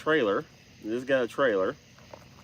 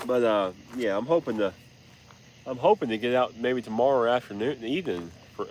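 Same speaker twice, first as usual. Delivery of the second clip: ordinary delivery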